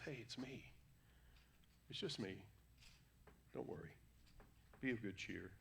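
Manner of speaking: nearly hushed, just reassuring them; hushed comfort. Acted. Jesus calming his scared disciples, hushed and tender.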